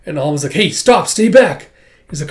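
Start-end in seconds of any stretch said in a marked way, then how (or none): 0.5-1.7 high, loud warning shout; acted alarm, raised voice; urgent warning. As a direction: shout them back urgently. Alma warns off thugs in fight.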